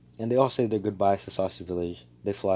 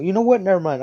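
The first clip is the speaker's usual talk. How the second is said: much higher, louder hurried retreat; panicked backpedal; 11 semitones higher, 10 dB louder, faster than usual. Retold or imitated. Asta backing off Noelle's slap threat, panicked backpedal. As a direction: back down fast in alarm.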